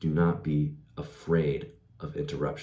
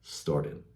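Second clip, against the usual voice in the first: "Started" dropped softer and falling; lower ending tone; 3 semitones lower, 5 dB quieter than usual. Shown as a demonstration. Coach repeating the ending to point out the drop, low falling "Started". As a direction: let "Started" sink low like a sentence's end. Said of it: "That was lower than the rest of my sentence".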